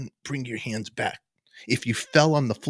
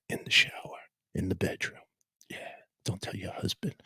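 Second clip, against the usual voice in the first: breathy late-night whisper; soft, unvoiced seductive whisper; 4 semitones lower, 8 dB quieter than usual. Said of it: "listen to radio voice".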